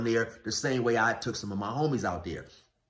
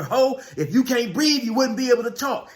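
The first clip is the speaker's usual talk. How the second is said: high, loud mock scolding; worked-up retort, raised well above normal speech; retold scolding; 11 semitones higher, 6 dB louder than usual. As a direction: imitate an angry man shouting down a plea. Retold angry retort to screaming woman.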